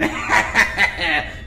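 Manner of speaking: loud theatrical laugh; hearty laugh. Acted. Sphinx boasts of drinking his wine, hearty stagey laugh. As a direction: laugh big at your own boast.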